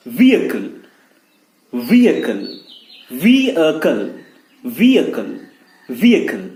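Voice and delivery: "vehicle" as VEE-uh-kul, falling each time; silent H drill. Shown as a demonstration. Teacher drilling what he said, silent H. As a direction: drill "vehicle" without H, pausing between repeats. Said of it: "मैंने क्या कहा?".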